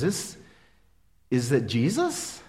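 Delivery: soft, slow, halting question; a mother slowly realizing who the Word is, quiet and halting; hesitant wonder. Retold or imitated. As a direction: let the realization come slowly and softly.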